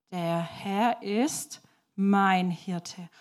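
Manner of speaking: very slow, with a gap after "ist"; word by word. Shown as a demonstration. Repeating the five-finger verse for children, word by word. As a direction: set each word apart, very slowly. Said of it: "Und genau so sagt er".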